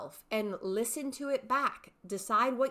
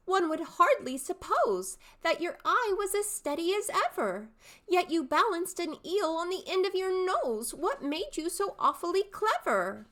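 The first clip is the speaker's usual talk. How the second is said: high, bright-eyed questioning youth; young man's voice; 9 semitones higher, 4 dB louder than usual. Shown as a demonstration. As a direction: pester the old man, pitched high. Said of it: "three distinct character voices".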